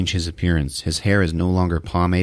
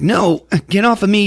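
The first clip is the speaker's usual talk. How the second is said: acted protest; 12 semitones higher, 3 dB louder than usual. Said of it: "She's like".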